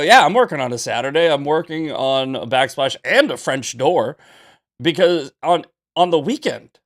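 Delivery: loud, fast defiant retort; retold snappy reply. Tradesman firing back at a pushy client, loud and fast. Retelling a heated text exchange.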